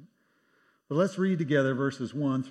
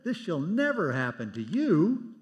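slow, raised and firm refusal; protesting rebuke; 5 semitones higher, 6 dB louder than usual. Retold or imitated. Preacher voicing Peter refusing Jesus' fate, slow raised protest. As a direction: plead against the thought with force. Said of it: "begins to chew him out".

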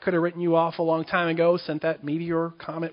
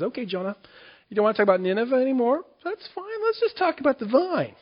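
coaxing God; 9 semitones higher, 4 dB louder than usual. Acted. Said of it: "And he says".

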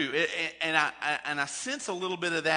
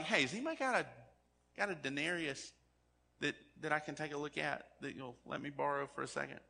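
quieter, easygoing request to borrow; softer casual ask; 7 dB quieter, faster than usual. Retold or imitated. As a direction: drop the voice and ask to borrow it lightly. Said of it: "And he's like".

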